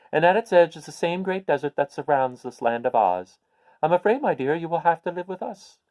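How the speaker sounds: put-on a little old witch, pleased to share good news. Witch breaks bad news.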